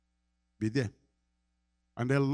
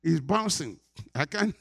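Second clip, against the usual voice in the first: imitated son; 5 semitones higher, 5 dB louder than usual. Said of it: "He said".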